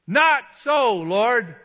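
loud, high shouted refusal; defiant refusal. Acted. Peter telling God no, defiant shout. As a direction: throw the order back loudly. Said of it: "He's telling God no".

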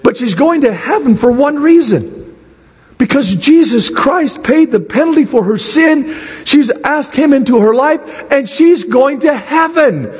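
high-pitched, emphatic preacher's voice; fervent sermon delivery; impassioned preaching. Real, not acted. Returns to forceful preaching.

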